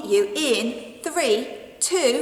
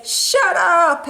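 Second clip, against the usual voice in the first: blunt shout; 7 semitones higher, 7 dB louder than usual. Acted. T-shirt slogan acted out.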